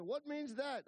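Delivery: high, loud demanding question; imitating Alvino. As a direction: play a baffled ranch hand speaking broken English. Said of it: "he said".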